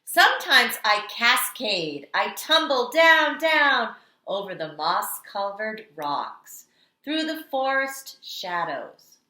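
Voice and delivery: storytelling read-aloud. Acted. Teacher reading The Water Dance to kindergarteners, dramatic storytelling. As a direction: read it like the water itself is dancing. Reads picture book aloud.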